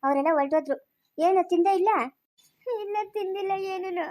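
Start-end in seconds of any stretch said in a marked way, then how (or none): 2.8-4.1 soft, slow tearful answer; quiet, dragging, weepy voice. Acted. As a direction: answer weakly, holding back crying. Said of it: "ಬಾಣಂತಿ ಅಳ್ಕುಡ್ದೆ".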